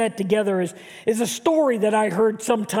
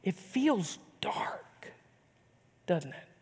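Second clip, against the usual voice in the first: hushed whisper; 4 semitones lower, 11 dB quieter, slower than usual. Acted. Pastor whispering to the congregation about darkness. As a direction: whisper it low and slow, drawing them in.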